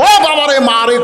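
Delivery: high-pitched yelp of pain; acted shout of distress; crying out. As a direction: wail it like someone who has just crashed down. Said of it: "করছে".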